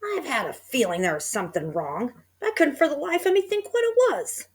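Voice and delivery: put-on puzzled old wife, voice climbing high; high puzzled old woman; Missus Twit voice. A narrator's reading. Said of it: "Missus Twit said".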